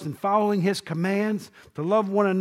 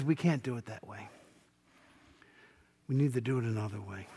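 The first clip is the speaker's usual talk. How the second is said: Jesus answering; 7 semitones lower, 8 dB quieter, faster than usual. Acted.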